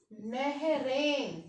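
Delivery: नहरें said very slowly, nasal ending clearly drawn out; slow nasal plural. Shown as a demonstration. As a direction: stretch नहरें so the nasal ें rings. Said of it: "हम जैसे बोलते हैं वैसे ही लिखते हैं". (नहरें धीरे-धीरे, अनुनासिक साफ़ सुनाते हुए)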